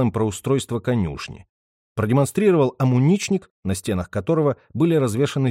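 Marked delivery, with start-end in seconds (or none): none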